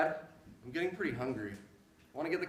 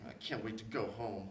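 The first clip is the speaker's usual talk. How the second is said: glum sulking; 11 semitones lower, 8 dB quieter than usual. Acted. Son sulking over cold beans.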